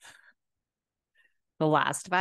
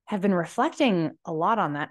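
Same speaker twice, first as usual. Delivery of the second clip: ordinary talk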